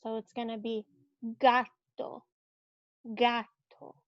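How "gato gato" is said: gato with a hard G, GAH-to. Shown as a demonstration. Teacher showing the hard G in gato. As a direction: model gato, hard G and open a. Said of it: "makes a hard G sound".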